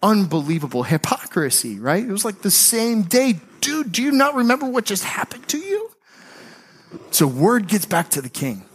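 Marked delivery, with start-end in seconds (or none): 3.6-5.9 high-pitched disbelieving question; incredulous rebuke. Acted. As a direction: challenge him, pitch jumping right up. Acted rebuke of the hypocrite servant.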